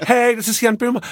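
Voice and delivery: high, bubbly influencer greeting; fashion blogger voice. Pretend. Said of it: "an so eine Modebloggerin".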